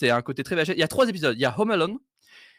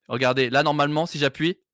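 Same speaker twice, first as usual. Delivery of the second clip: ordinary talk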